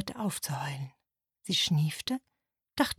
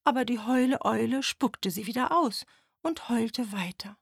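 ordinary delivery

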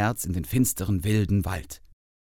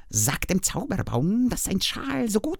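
very high, friendly spider voice; a chatty spider passing on news; 11 semitones higher than usual. A narrator's reading. Spider sends message to tree.

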